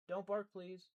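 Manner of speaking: loud, high call to the dog. Real, not acted. Reader calling to her barking dog. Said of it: "is he gonna keep barking".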